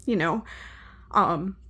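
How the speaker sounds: ordinary talk, nothing marked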